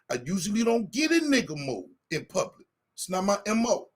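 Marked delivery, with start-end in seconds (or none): none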